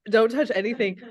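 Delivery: usual speaking voice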